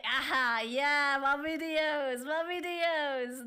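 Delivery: lightly mock his smugness; mocking the boy. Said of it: "My boy is like".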